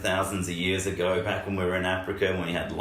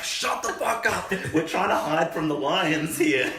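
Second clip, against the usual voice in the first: shouting whisper; 8 semitones higher than usual. Retold or imitated. Ancestors hushing the baby.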